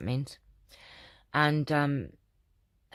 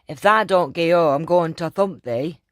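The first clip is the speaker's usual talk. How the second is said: loud mock Yorkshire threat; Yorkshire accent threat; 3 semitones higher, 8 dB louder than usual. Mum to daughter, mock-threatening shout in Yorkshire accent. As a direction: bellow it in broad Yorkshire, half joking. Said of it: "my accent is okay".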